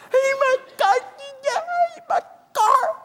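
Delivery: very high, hammed-up crying voice; mock anguished wail. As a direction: wail over it like a tragedy, exaggerated. Owner sees car door dinged.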